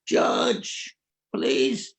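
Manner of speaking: mild mock scream, pitch leaping on the first word; retold scream. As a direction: imitate a wife's desperate outburst without fully shouting. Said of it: "She, she screams".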